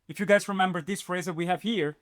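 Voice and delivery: ordinary delivery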